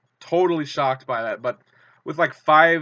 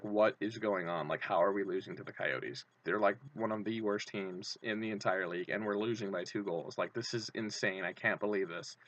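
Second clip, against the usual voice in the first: lower, softer, deflated grumble; lightly voiced glum rival fans; mocking the dismayed fans; 4 semitones lower, 10 dB quieter than usual. Penguins fans baffled at losing to the Coyotes, glum. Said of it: "all the Penguins fans were like".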